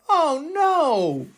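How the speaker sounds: ordinary delivery, nothing marked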